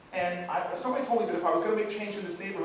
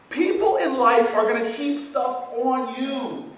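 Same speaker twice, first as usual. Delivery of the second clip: normal talking voice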